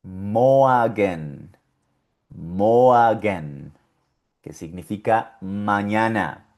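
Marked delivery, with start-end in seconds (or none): 0.0-3.7 moagen as German morgen, R vocalized. Shown as a demonstration. Teacher modeling German morgen. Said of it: "y suena".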